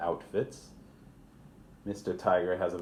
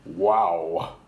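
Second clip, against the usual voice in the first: rhino wow; 8 dB louder than usual. Acted. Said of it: "says Mister Rhino".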